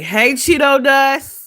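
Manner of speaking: loud, high, drawn-out teasing call; mock calling out. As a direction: holler it teasingly, stretched out. Mock greeting for his chat.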